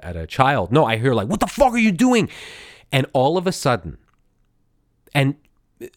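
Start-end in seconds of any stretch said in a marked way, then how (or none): 1.3-2.3 scolding like a fed-up parent, higher; exasperated parent. Retold or imitated. Guard scolding inmate like a fed-up parent, exasperated scolding. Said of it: "a parent exasperated as, at a child".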